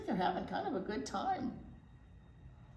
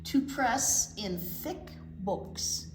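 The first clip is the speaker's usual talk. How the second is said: lively picture-book read-aloud for little ones; reading to children; 4 semitones lower, 5 dB louder, slower than usual. Acted. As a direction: tell it like a storybook to a circle of kids.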